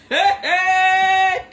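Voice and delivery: very high, loud shouted hey hey; shouted call. Real, not acted. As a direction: yell it to grab the whole chat. Loud call to chat viewers.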